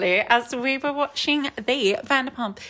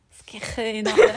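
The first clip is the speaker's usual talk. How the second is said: laughing; 4 dB louder, much slower than usual. Acted. Joking about skin.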